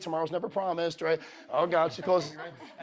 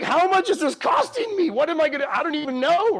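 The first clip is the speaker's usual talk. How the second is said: high, loud mock panic about the price; panicked thought; 7 semitones higher, 7 dB louder than usual. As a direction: sound like the bill just hit you, loud and high. Panicking over trip cost.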